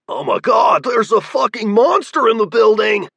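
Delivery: high, loud panicky yelling; panicked cry. A narrator's reading. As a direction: freak out at the monster. Panicked newcomer sees monster.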